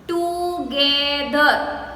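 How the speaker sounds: "Together" very slow as to-ge-ther; divided into syllables. Shown as a demonstration. Teacher dividing a longer word for pupils, "Together" syllable by syllable. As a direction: stretch each syllable of "Together". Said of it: "We don't divide it".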